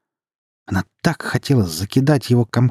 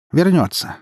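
assured, higher-pitched reply; confident; 8 semitones higher, 4 dB louder than usual. A narrator's reading. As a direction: answer with breezy certainty. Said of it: "уверенным голосом ответила дочь".

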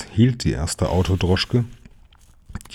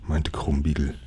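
ordinary delivery

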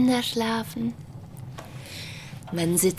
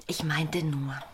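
whisper; 4 semitones lower, 5 dB quieter than usual. Acted. Wife hesitantly explains to husband.

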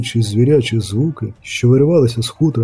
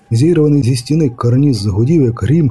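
ordinary delivery, nothing marked

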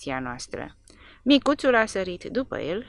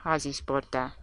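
usual speaking voice

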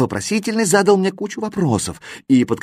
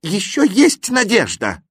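high, drawn-out cry of hope; fervent raised encouragement; 9 semitones higher than usual. A narrator's reading. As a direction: exclaim it urgently, pitched high. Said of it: "крикнул восклицательный".

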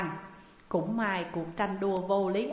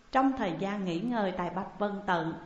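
usual speaking voice

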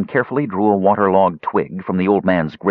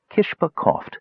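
ordinary delivery

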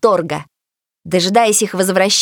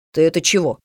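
put-on boy voice; 7 semitones lower, faster than usual. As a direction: lower boyish voice. Brother Finn asks.

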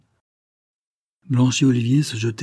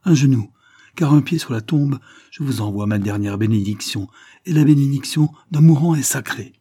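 loud, raised priestly proclamation; strong voice; 4 semitones higher, 6 dB louder than usual. A narrator's reading. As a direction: proclaim a dying man's blessing. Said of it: "s'écria-t-il d'une voix forte".

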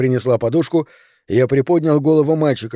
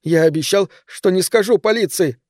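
panicked insistence; 7 semitones higher than usual. A narrator's reading.

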